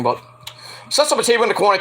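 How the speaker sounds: ordinary talk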